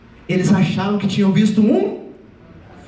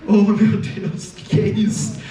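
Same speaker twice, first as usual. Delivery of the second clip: slow, muted exasperation; understated "what is this" dismay; dismayed Jesus; 5 semitones lower, slower than usual. Acted. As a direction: sigh it out in weary disbelief. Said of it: "Jesus deve ter olhado para aquilo".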